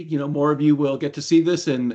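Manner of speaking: ordinary talk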